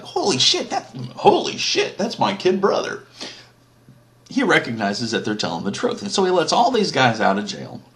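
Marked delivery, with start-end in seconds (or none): 0.0-3.0 stunned disbelief. Retold or imitated. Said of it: "and Joseph's like".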